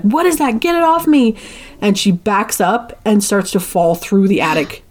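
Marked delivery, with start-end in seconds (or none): 0.0-1.3 panicked. Retold or imitated. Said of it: "she starts to panic and freak out".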